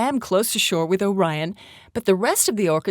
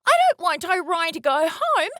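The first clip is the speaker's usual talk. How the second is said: put-on high-pitched childlike protest, only mildly raised; loud protest; 10 semitones higher than usual. A narrator's reading. Baby orca objecting to Orion going home, high loud protest. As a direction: protest in a high, insistent little voice. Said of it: "sounded Letty as loud as she could".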